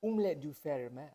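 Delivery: mock French phrase in a put-on accent. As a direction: play at sounding French. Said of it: "say it with the French accent".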